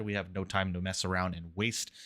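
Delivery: ordinary talk, nothing marked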